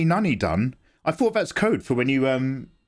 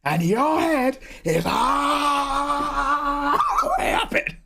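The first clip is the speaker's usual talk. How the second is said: much higher, very slow, strained near-cry; more energy; 12 semitones higher, much slower than usual. Retold or imitated. Streamer redoing the Pacino line with more energy, strained. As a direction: stretch it out until the voice cracks. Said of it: "I like to throw more energy in it".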